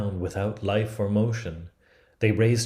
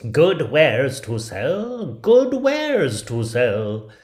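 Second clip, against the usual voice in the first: high, loud, slow hawker call; peddler crying wares; 6 semitones higher, 9 dB louder, much slower than usual. Acted. Disguised queen calling at the door again, loud sing-song. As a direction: drag out the old woman's cry. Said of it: "knocked at the door and cried".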